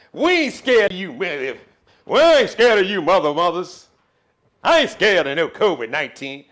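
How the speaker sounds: loud, high, defiant country bravado; Bernie Mac impression. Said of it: "country ass Bernie Mac impression".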